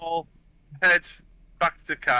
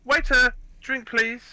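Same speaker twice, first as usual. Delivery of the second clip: higher, drawn-out call for service; calling a waiter; 8 semitones higher, slower than usual. Acted. Bar patron summons waiter for a drink, higher slow call. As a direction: hail the waiter in a raised pitch. Player calls the waiter over.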